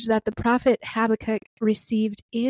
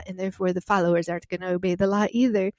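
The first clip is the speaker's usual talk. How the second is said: usual speaking voice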